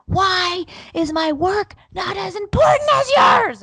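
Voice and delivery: high, loud, slow outburst; losing it. Retold or imitated. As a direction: scream it at your husband. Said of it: "I just lose it".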